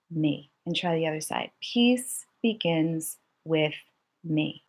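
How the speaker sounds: slow, even chanting of the mantra. Shown as a demonstration. Teacher leading children in a slow mantra. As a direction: lay each word down slowly and calmly. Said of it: "the mantra that we wanna say is simply".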